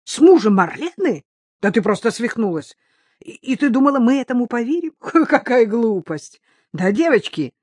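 high-pitched, laughing disbelief; scoff, appealing to friends for backup; agitated, stammering, derisive astonishment; incredulous scoffing. Acted. Acted incredulous outburst, scoffing laugh.

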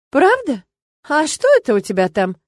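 high, pointed questioning; catching him out. A narrator's reading. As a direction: pounce on his slip with a high query. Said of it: "подловила я его".

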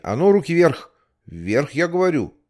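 loud, high order hands up; stern shout. Acted. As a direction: yell the command at the kids. (строгий окрик)

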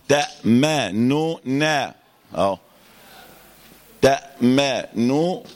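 تأمننا drawn out very slowly, lips rounded on the nun; slow ishmam demo. Shown as a demonstration. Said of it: "كده أهو". (نطق تأمننا ببطء مع الإشمام)

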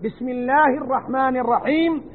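usual speaking voice

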